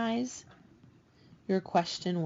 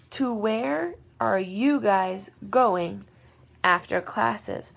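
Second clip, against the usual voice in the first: slow translation; much slower than usual. Shown as a demonstration. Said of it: "teacher reads translation slowly".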